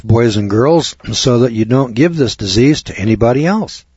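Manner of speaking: ordinary talk, nothing marked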